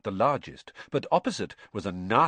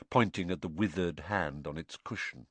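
ordinary delivery

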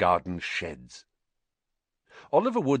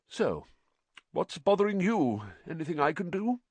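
calmer tone; 9 semitones higher than usual. A narrator's reading. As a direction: settle down and ask gently. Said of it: "in a far calmer tone".

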